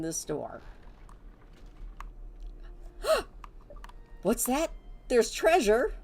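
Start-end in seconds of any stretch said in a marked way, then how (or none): 1.6-4.2 sharp dramatic gasp; mock gasp. Finds treasure behind secret door. 4.2-5.9 thrilled discovery, higher and louder; excited. Pretend. Finds treasure behind secret door.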